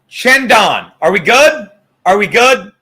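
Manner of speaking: asking Shandon loudly if they're good after the shot. Real, not acted. Loud check-in. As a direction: check in loud, half-joking.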